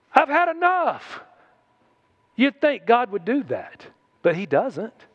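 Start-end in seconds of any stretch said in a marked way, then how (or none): 0.1-1.2 higher, exasperated sigh of a line; fed up. Retold or imitated. Preacher imagining God fed up with whiners, mock exasperated. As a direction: throw up your hands in mock exasperation. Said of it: "like that verse".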